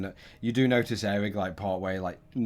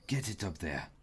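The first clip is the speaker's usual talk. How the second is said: muffled, as if through a wall; 3 dB quieter than usual. Acted. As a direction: call out from inside a tunnel, muffled. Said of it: "like, the muffled voice".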